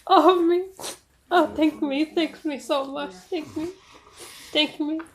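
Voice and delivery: high, playful self-congratulating; self-praise voice. Pretend. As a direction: pat yourself on the back, sing-song. Thanking herself, high mock voice.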